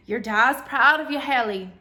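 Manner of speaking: lilting Irish grandfather sharing a saying; thick Irish brogue. A narrator's reading. Ancestor Patrick advising Haley in a thick Irish brogue. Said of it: "Patrick in his thick Irish brogue".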